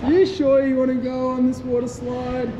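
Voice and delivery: high, playful teasing question. Real, not acted. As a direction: tease them lightly.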